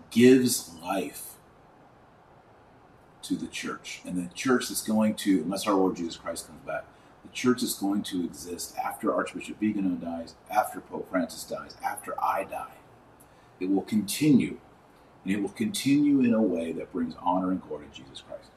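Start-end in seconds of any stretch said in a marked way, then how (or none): none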